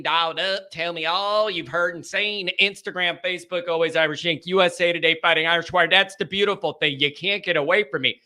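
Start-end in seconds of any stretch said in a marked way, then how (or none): none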